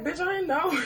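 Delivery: high, indignant mock retort; cousin's retort. Cousin snapping back that she didn't know, high and defensive.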